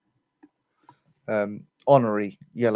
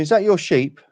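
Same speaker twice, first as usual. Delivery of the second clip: Englishman asking; 8 semitones higher, 7 dB louder than usual. Acted. Englishman asks, higher.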